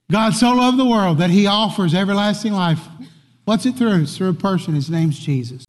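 normal talking voice

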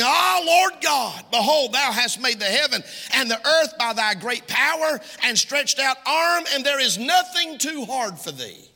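high, ringing scripture reading; exclamation. Acted. Preacher reading Jeremiah's verse to the congregation, exclaiming. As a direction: proclaim the verse with fervour. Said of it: "with an exclamation point".

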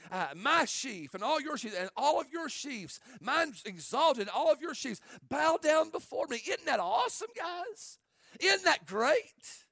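put-on a kid boasting to his brothers, rising excitement; excited Joseph. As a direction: gush about the dream, eager to show off. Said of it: "All excited about it".

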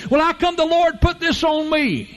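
mock the grumbler; complaining churchgoer. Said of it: "folks say".

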